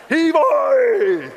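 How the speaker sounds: pirate cry. Retold or imitated. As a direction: belt it out like a little boy playing pirate. Son shouting pirate cry.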